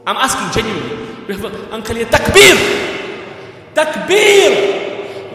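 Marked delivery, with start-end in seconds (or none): 2.0-5.3 loud high shouted takbir; much louder, higher rally shout; call of takbir. Real, not acted. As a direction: rouse the hall to answer. Calling crowd to cheer.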